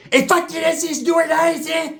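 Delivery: high charged battle yell; fight cry. Retold or imitated. Cousin throws a punch, high charged yell. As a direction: hype yourself up high into the swing. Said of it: "my primo took a swing".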